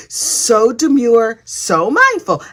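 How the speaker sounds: very slow, drawn-out prim words; hammed-up demure purr; mock demure. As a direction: stretch each word coyly. Mocking demure self-description.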